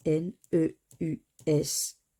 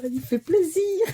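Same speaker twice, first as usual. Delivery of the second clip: higher, laughing pleased line; 10 semitones higher, 5 dB louder than usual. Real, not acted. Pleased with the ticket, laughing happily. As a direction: laugh through it with joy.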